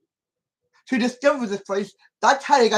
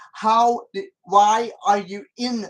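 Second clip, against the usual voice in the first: ordinary talk, nothing marked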